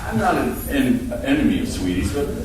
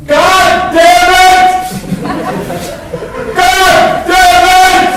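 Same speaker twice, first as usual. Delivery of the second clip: loud, high, slow bellow; furious neighbor yell; 9 dB louder, much slower than usual. Retold or imitated. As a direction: yell at the whole street. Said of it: "and she goes".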